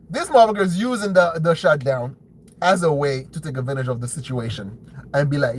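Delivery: usual speaking voice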